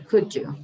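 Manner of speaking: Could you spoken as "couldja"; blended could you. Shown as a demonstration. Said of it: "I'm going to repeat only the sound".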